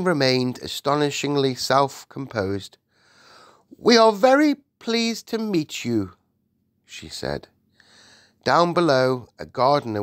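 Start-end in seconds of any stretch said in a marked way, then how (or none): 3.8-6.1 high-pitched regal greeting; gracious Queen. Acted. Queen welcomes the giant, gracious and high. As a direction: receive a guest in a raised royal voice.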